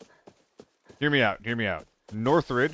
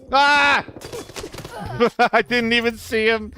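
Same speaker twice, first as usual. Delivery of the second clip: high, loud shocked cry into a laugh; startled yell; 12 semitones higher, 7 dB louder than usual. Real, not acted. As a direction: caught off guard, yelp and laugh. Killed suddenly in game.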